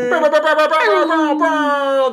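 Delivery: rolled lips drumroll; high trilling drum roll sound; mouth drum roll. Acted.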